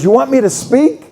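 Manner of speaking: light imitation, offering to speak; Trump offering. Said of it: "he goes".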